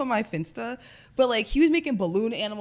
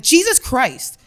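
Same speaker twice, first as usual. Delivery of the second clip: loud, higher startled exclamation; retold alarmed outburst; 6 semitones higher, 11 dB louder, much slower than usual. Passenger as driver nearly hits a guardrail, alarmed. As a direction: re-enact a shocked yell. Retells shock at near crash.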